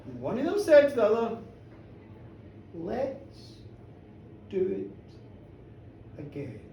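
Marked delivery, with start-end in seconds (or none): 2.7-6.6 soft, slow, dropping to near silence; suggestive hush. Acted. One spouse tempting the other, soft slow hush. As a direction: breathe it out slowly, teasing.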